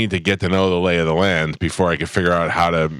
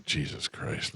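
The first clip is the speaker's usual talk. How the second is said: whispered; 4 semitones lower, 15 dB quieter, slower than usual. Retold or imitated. Retold muttered complaint on boat.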